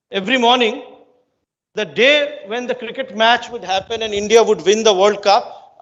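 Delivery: ordinary talk, nothing marked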